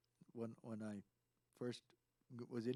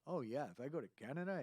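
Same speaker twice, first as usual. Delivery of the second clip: ordinary delivery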